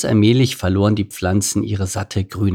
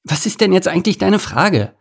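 high, sharp impatient demand; impatient snap; 8 semitones higher than usual. A narrator's reading. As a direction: snap at him to get on with it. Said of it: "herrschte sie ihn ungeduldig an".

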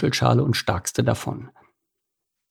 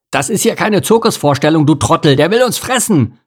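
louder, higher urgent scolding; a panicked eel snapping, louder; hissing rebuke; 7 semitones higher, 5 dB louder than usual. Acted. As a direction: snap at the fool to hurry. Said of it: "zischte Anton".

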